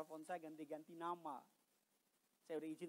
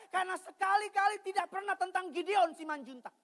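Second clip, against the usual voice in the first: full-force preaching; 14 semitones higher, 16 dB louder than usual. Acted. Pastor preaching loudly to the congregation. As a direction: preach it out to the whole church.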